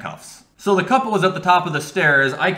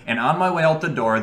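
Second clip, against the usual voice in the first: ordinary delivery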